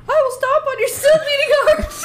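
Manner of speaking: high, loud dramatic villain line; character line. Pretend. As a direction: overplay it like an anime villain. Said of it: "I have a line".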